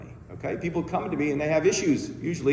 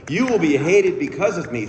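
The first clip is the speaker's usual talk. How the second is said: louder, weightier scripture quote; Jesus quote, stronger; 4 semitones higher, 6 dB louder than usual. Retold or imitated. Speaker repeating the verse to the crowd, louder and declaiming. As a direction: lean into it, declaiming. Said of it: "in which he says".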